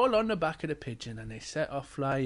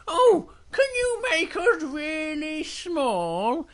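high, slow little-bear plea; 11 semitones higher, much slower than usual. Acted. Little Bear begs the princess, high and drawn-out. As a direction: stretch it out in a high tiny-bear voice. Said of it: "And Little Bear goes".

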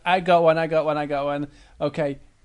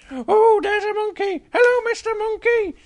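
very high, loud, excited cub; Little Bear; 6 dB louder than usual. Acted. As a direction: squeal a delighted hello as a little bear. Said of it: "So Little Bear just sat looking forward".

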